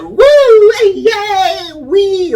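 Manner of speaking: high, loud whooping on the ride; screaming with joy on the coaster; mock cheering. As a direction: whoop like the ride is the best thing ever. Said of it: "We like".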